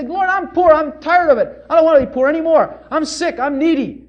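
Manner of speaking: high, loud pleading prayer voice; sample prayer. Shown as a demonstration. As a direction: cry out like a needy man begging God. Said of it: "Just say".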